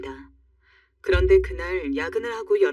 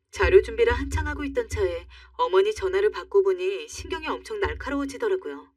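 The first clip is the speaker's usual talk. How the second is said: plain reading voice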